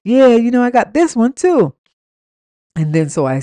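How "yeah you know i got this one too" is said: imitating his eager bragging, higher and louder; lightly mimicked excitement, pitched up; retold excitement. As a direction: show it off like an excited man. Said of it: "all of a sudden he gets excited".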